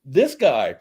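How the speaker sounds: high, eager hell yeah; excited yes. Retold or imitated. Rider eagerly claiming the single rider seat, excited. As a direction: jump at the chance, thrilled. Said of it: "And I'm like".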